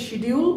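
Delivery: শিডিউল with shee at the start; common mispronunciation. Shown as a demonstration. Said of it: "আমরা অনেকেই বলি". (শিডিউল, প্রচলিত ভুল উচ্চারণ)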